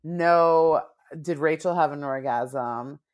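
ordinary talk

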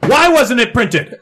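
loud, high, slow demand; angry. Acted. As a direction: confront the chief in anger. Reporter confronts the chief.

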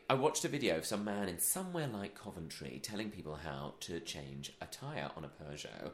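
ordinary talk

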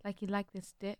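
put-on American dirty-talk voice; American twang. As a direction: murmur it in an imitation American accent. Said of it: "an underlying American twang".

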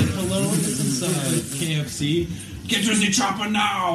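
hammed-up robotic prank-call voice; robot Arnold Schwarzenegger. Mocking a prank call's fake robot Arnold, high and slow. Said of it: "they put in a robot voice for, like, Arnold Schwarzenegger".

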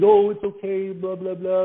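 put-on high, sing-song babying coo; mock baby voice. Father mocking how adults talk to young kids, baby voice. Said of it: "I wouldn't use that baby voice".

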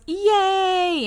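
high, drawn-out mock yay; happy cheer. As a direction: cheer gleefully in a high pitch. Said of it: "she's really happy about it".

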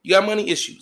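sudden high, loud okay; hammed-up high-pitched yell; mock shouted acceptance. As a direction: burst out high and loud in reply. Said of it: "I say".

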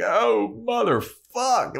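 high, drawn-out pained wail; mock agony. Buffy writhing on the ground, mock agonized. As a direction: wail it out in hammed-up pain. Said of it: "writhing on the ground in pain".

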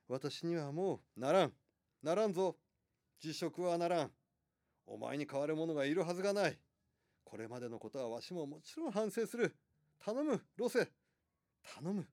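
insist, then plead as the ruler; insistent emperor. Acted. Emperor refuses resignation, begs.

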